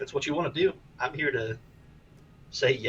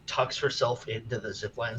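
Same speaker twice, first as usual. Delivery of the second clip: ordinary talk, nothing marked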